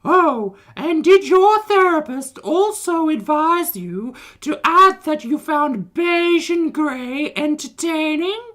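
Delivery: high, loud, drawn-out indignation; theatrical outrage. Acted. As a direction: declaim it slowly as an over-the-top tirade. Dramatic read-out of someone's angry message.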